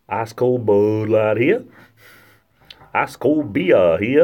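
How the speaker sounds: loud, drawn-out Boston vendor's call; Boston accent. Shown as a demonstration. Beer vendor calling to the crowd, loud Boston accent. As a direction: hawk it slowly in a Boston accent. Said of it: "you got the Boston accent".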